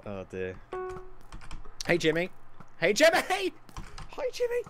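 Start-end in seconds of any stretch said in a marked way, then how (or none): 2.8-3.2 high, loud teasing hail; louder call. Real, not acted. Gamer pestering rival Jimmy, high loud call. Calling to the opponent, escalating. 3.3-4.6 silly falsetto hello; squeaky greeting. Real, not acted. Gamer greeting rival Jimmy, squeaky falsetto.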